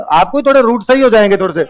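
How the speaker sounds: high, loud playful quip; teacher mocking the parrot story; amused joking. Real, not acted. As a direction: tease the class about parrot navigation. Joking about parrots giving directions.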